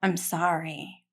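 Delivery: soft choked apology; emotional whisper. Real, not acted. Apologising to listeners, whispered and moved. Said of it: "That's emotional".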